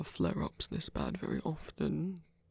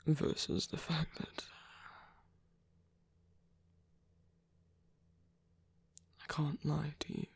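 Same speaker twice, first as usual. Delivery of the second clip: confiding whisper. Acted. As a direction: whisper it honestly. Unwell, confiding from bed.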